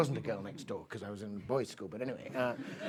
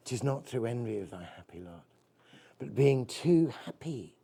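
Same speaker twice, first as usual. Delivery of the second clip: poem recital; 5 dB louder than usual. Acted. Recites Keats ode.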